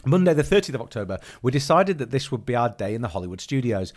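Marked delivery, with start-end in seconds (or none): none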